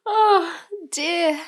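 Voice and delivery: high, loud mock sympathy; mock pity. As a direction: coo in fake concern. Mocking the captive.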